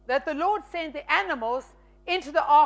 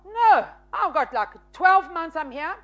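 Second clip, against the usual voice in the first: protesting to God; 3 semitones higher, 5 dB louder than usual. Retold or imitated. Student argues with God about the offering, loud protest. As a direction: push back hard on the offering. Arguing with God in her head.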